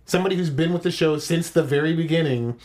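ordinary delivery

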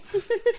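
squealing high laugh. Real, not acted. Laughs at dinosaur noise.

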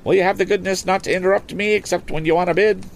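high, brisk auctioneer selling a boat; auctioneer's pitch. Acted. Auctioneer coaxing bids from the lakeside crowd, lively and high.

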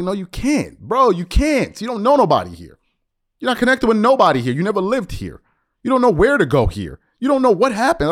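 high, loud retort from a retold argument; heated rebuttal. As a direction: shoot him down loudly, pitch way up. Retold argument with a boastful man.

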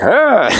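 high-pitched mock boyish "ah!"; prepubescent outburst. Friend imitating his boyhood buddy's one-man show, prepubescent yelp. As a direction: burst out high like a hyper kid. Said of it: "he would be like".

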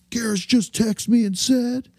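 high, strained monster truck voice, stretching the last words; pro wrestling announcer. Shown as a demonstration. Caller lying about the bar owner's text, monster truck announcer. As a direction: hype it like a wrestling promo. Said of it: "It's like really pro wrestling".

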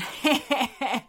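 mocking staccato chuckle; dingo chuckle. A narrator's reading. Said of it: "chuckled the lead dingo".

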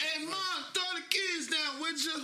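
high shout up toward a third-floor window; yelling up to mom. Acted. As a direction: holler up to mom to throw the keys. Kid calling up to mom.

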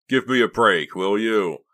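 wild outburst. A narrator's reading. Boy tells his friend to back off, wildly exasperated. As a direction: snap back at full volume. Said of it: "Clay interrupted wildly".

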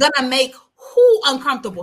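loud, high-pitched incredulous comeback; acting out his outrage, hitting "who" hard; indignant retort. Acting out actor's indignant refusal.

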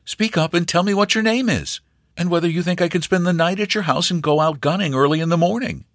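put-on young stranger rushing his questions; eager young hunter. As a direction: hurry her along, friendly but pushing. Stranger keeps asking.

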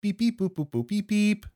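beeping dial tones; toy robot voice. Pretend. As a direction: chirp each beep like a keypad. Dialing the hacker's phone.

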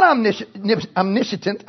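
"omnisci-" broken off, then "omniscient" as om-NISH-uh-tent; tongue-tied mispronunciation. Real, not acted. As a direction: trip over "omniscient", retry with an extra syllable. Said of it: "I can't even say it twice in a row".

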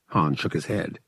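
ordinary delivery

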